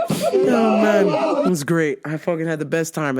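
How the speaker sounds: normal talking voice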